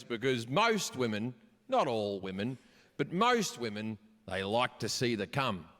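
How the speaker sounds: put-on low-pitched old father explaining; deep, measured dad voice; retold elderly dad. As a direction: impersonate an elderly dad imparting advice.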